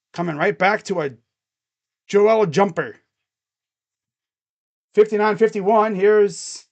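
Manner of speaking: live play-by-play. Acted. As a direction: call the action like a TV announcer. Streamer calls the game live.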